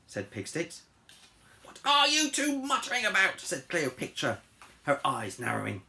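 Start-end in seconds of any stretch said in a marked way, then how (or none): 1.6-3.4 high, loud queenly voice; imperious demand. Acted. Cleopatra catching the pigs muttering, indignant and loud. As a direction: demand it haughtily.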